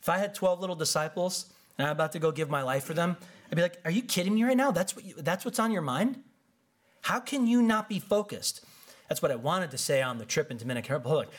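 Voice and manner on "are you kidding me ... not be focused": high, incredulous mock scolding; acted exasperation, voice jumping up in pitch; exasperated disbelief. Leader to twelve disciples before dying, incredulous scolding.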